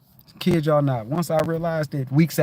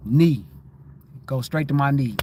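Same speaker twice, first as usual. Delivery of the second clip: normal talking voice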